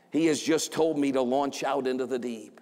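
ordinary talk, nothing marked

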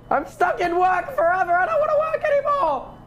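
high-pitched, dragged-out whining; hammed-up high whine of protest; whiny mock tantrum. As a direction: wail like you're trapped at work forever. Mock tantrum, stuck at work.

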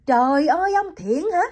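Vũ hears Ông Thiện came, crying out in shock; cry out in surprise. Acted. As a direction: gasp it out in dismay. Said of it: "Vũ kêu lên".

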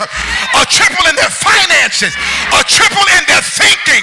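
loud, high, shouted preaching; preaching at full force. Real, not acted. Pastor shouting God's pride to the church. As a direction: whoop it to the back row.

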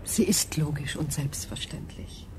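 soft, even whisper; calm whisper. Acted. Berenice whispering calm agreement to Adrian. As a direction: whisper it as simple fact. [name] calmly confirms.

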